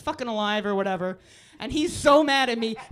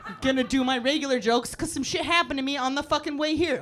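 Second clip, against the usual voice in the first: ordinary delivery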